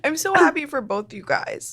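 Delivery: high, tearful laughing congratulations; emotional. Real, not acted. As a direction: overcome with happiness for them. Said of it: "I'm getting so emotional".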